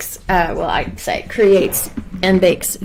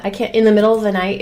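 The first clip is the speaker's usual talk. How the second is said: usual speaking voice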